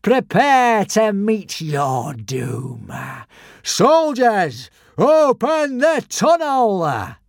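loud, slow commanding call; threaten, then order the soldiers; menacing shout. A narrator's reading. Alvin threatens Hiccup and commands soldiers, loud and slow. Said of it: "shouted Alvin".

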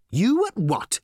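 high-pitched, disbelieving question; incredulous interruption. A narrator's reading. Reporter interrupts the corporate speech, incredulous.

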